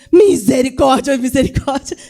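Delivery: loud, high cry for mercy; mock horrified exclamation. Exclamação encenada diante da confissão. (exclamação alta de espanto encenado)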